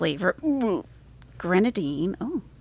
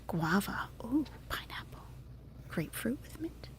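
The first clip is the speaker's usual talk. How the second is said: soft unvoiced whisper naming flavors; 4 semitones higher, 12 dB quieter than usual. Real, not acted. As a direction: whisper each flavor softly.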